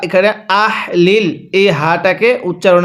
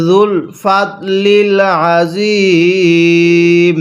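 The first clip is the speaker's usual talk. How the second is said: very slow, every vowel stretched; slow recitation; much slower than usual. Shown as a demonstration. Teacher models slow recitation. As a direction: lengthen each syllable. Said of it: "হ্যাঁ, কিভাবে পড়ব?".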